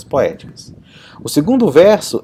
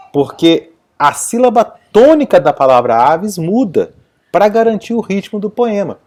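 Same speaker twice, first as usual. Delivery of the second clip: normal talking voice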